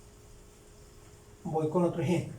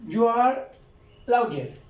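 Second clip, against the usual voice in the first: emphatic model; 2 semitones higher, 5 dB louder, slower than usual. Shown as a demonstration. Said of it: "Ahí estoy diciendo".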